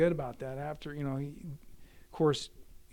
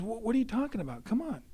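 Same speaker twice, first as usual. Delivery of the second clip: quick, high-pitched objection; retold incredulous protest; 9 semitones higher, faster than usual. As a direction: act out a buddy's baffled pleading. Robert protests at leaving, retold.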